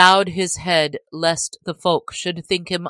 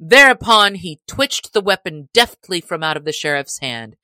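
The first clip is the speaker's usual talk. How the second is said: ordinary delivery, nothing marked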